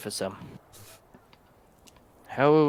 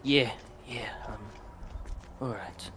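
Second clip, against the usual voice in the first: whispered; 4 dB quieter than usual. Acted. Half-orc keeping his voice down.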